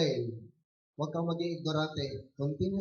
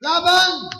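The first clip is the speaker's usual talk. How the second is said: high, loud one-word battle cry; rallying shout; 11 semitones higher, 13 dB louder than usual. Real, not acted. Preacher urging workers to stand for Sunday, rallying shout. Exhorting congregation to fight.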